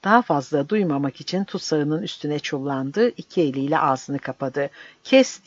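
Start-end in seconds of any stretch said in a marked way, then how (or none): 5.0-5.4 sharp, high, loud cry; yelling. Acted. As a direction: cut him off with a shout. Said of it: "diye haykırdı". (yüksek perdeden sert haykırış)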